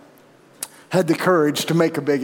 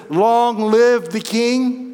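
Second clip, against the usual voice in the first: slow proclamation; 5 semitones higher, much slower than usual. Acted. Preacher acting greeting to king.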